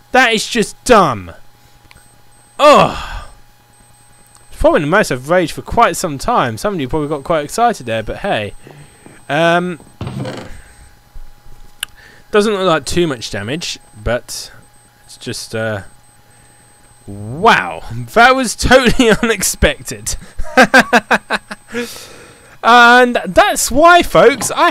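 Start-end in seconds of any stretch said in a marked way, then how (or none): 2.6-3.3 loud frustrated ugh; groan. Real, not acted. Raging at a mini zombie. 18.1-21.9 laughing through the words; laughing after being caught out. Real, not acted. Laughs after surprise attack.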